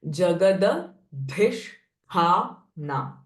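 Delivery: "Jagadadhisthana" very slowly, dh and th pushed from the chest; forceful aspiration. Shown as a demonstration. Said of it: "feel the force in your chest area". ("Jagadadhisthana" drawn out with strongly aspirated dh and th)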